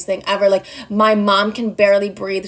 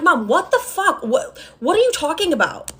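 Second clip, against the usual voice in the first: exasperated disbelief; 7 semitones higher than usual. Retold or imitated. Exasperated retort to mom.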